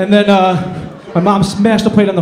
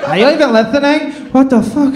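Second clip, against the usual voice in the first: higher, mock-irritated drunk girl; a hint of the drunk girl snapping at him; annoyed drunk girl; 10 semitones higher, 3 dB louder than usual. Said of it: "drunk girl scolds him".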